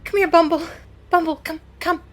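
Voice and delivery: high, eager call to a pet; girl calling her pup; calling the dog. Acted. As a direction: coax the dog over.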